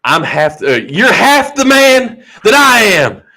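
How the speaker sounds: slow, high-pitched wrestler boast; mock wrestling promo, slow and emphatic; Sid Vicious. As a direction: drag out each botched promo phrase. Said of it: "Sid Vicious?".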